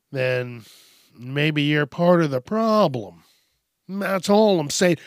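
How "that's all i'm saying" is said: ordinary delivery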